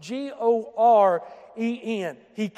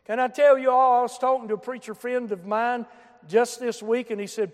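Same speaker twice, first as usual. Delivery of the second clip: ordinary delivery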